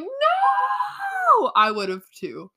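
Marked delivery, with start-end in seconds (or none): 0.0-1.5 high, loud anguished mock scream; breakdown scream. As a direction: scream out in grief. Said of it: "has a mental breakdown".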